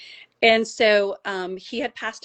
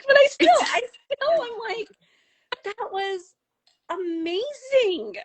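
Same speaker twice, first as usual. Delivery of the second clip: real high, tearful voice overcome with awe; tearing up; 14 semitones higher than usual. Real, not acted. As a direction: squeeze the words out through happy tears, drawing out the end. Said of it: "I'm still tearing up".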